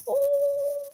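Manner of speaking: high-pitched cartoon 'oh'; airy, drawn-out animated-movie coo. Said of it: "it's really soft. Like, even the Disney movie".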